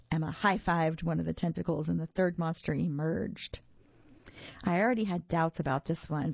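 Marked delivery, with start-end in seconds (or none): none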